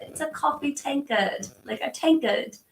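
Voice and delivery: put-on playful, imperfect UK accent; attempted UK or South African accent. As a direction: try a little British or South African lilt. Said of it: "a U K South African".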